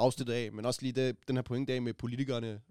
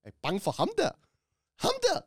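high mocking taunt, pitch leaps up on the second der. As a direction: tease like schoolkids. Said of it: "de lavede decideret den der".

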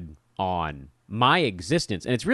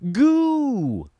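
high, sung-out "Goo" like a camp counselor; calling out "Goo" to a group of kids; 3 dB louder than usual. Retold or imitated. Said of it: "the counselor would say".

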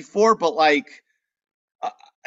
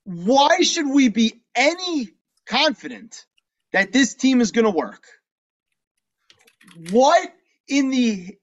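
emphatic rhetorical challenge; 4 semitones higher than usual. Real, not acted. Podcaster challenging faith in the Nets, emphatically sceptical.

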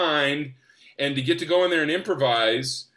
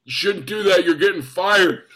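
raised mock warning; messing with him; 3 semitones higher, 5 dB louder than usual. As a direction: tease him with a pretend dire verdict. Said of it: "he starts messing with me".

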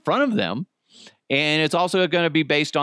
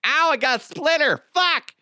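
squealing in pain. Acted. Character hurt by splinter.

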